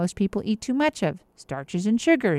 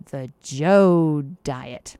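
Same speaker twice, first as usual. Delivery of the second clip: slow, drawn-out reveal; big reveal; 5 semitones lower, 5 dB louder, much slower than usual. Shown as a demonstration. Doctor revealing the diet's name to Joe, slow reveal. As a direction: unveil the name slowly like a prize. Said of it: "You know what it's called?".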